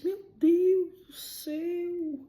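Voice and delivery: awed shock. Retold or imitated. Shocked reaction to dead friend.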